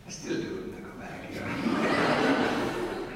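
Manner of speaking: laughing through the words. Real, not acted. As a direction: chuckle while speaking. Laughing about still doing it.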